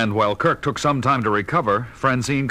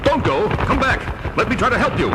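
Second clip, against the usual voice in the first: calling after; 12 semitones higher than usual. Acted. Batman calls after fleeing Man-Bat.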